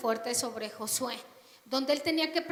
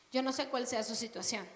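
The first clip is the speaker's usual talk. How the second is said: normal talking voice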